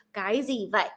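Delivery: Northern Vietnamese, gì as zee and vậy with a v; Northern Vietnamese accent. Shown as a demonstration. Contrasting North with South for listeners, Northern Vietnamese accent. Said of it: "But then the North is".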